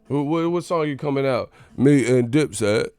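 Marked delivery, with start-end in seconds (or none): 1.8-2.9 Forrest Gump voice. Retold or imitated. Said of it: "[name] Gump".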